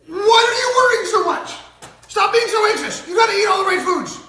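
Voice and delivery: very high, loud hammed-up shouting; mock parental tirade, shouted at full pitch; yelling parent. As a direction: holler at a child like an overwrought parent. Acts parent yelling at six-year-old.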